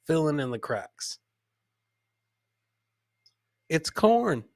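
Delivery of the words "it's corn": normal talking voice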